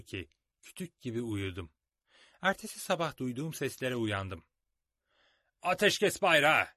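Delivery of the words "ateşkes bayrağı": loud, slow call; shout. A narrator's reading. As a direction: call out the truce flag across the camp. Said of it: "diye bağırdı birileri". (bağırarak)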